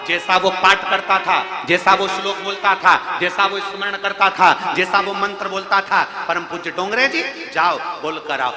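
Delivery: divine command. Retold or imitated. Goddess Durga commands Dongre ji.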